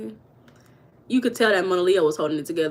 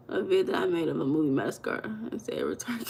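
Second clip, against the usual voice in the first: whispered read; 4 semitones lower, 7 dB quieter than usual. Real, not acted. Reading chat comment.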